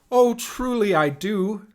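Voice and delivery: high, loud and delighted; hooting with delight. Acted. Uncle Fred hoots over Rosie's cheese hat, high and loud. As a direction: uncle laughing, hooting his praise. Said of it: "Fred hooted".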